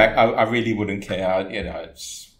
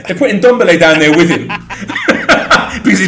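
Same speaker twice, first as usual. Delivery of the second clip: laughing; 9 semitones higher, 13 dB louder, faster than usual. Real, not acted. Laughing at own basement joke.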